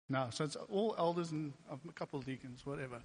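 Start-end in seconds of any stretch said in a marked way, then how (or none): none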